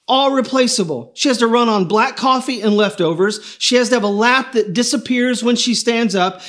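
God proudly listing the specs aloud; a hint of a proud, eager creator; God's voice. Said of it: "And God said".

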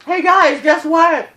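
high, loud, manic; frantic announcement. Real, not acted. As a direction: announce it in exasperation. Exasperated address to viewers.

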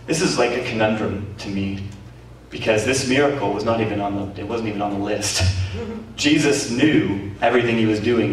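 normal talking voice

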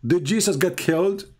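ordinary delivery

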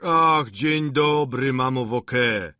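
high, drawn-out cheery call; exclaimed greeting. A narrator's reading. Lodger greeting his landlady, drawn-out exclamation. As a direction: call out a greeting, stretching the words. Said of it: "zawołał".

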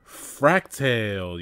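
loud, slow cheer; joyful yell. Acted. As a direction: greet your rescuer. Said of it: "yelled the bo-, two brothers with joy".

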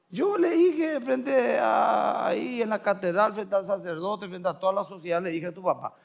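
high-pitched retelling, easing down gradually; imitated insistent mother. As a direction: act a mother firmly recalling her vow. Mother explains her wedding vow. (madre imitada, voz aguda que va bajando)

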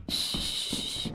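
hushed urgent whisper. Real, not acted. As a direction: hush someone in fear. Hiding from a monster.

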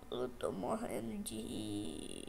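low, slow, drawn-out murmur; quiet and slow. Real, not acted. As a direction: let it sink down quietly and slowly. Repeats the phrase in new ways.

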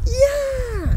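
high excited cheer; sudden high yell of a cheering crowd; cheering fan. Acted. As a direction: whoop it up like a fan in the stands. Fans cheering at the game.

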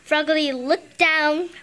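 usual speaking voice

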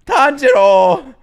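long, high, loud cry of the name; excited shout. Real, not acted. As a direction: hail him like a hyped fan. Excited fan hails a character.

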